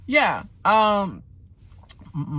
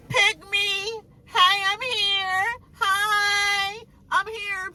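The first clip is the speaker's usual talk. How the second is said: high, drawn-out little greeting; high put-on eager voice; mock attention-seeker; 13 semitones higher, much slower than usual. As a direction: pipe up in a slow, high singsong. Acts out attention-seeking cast member.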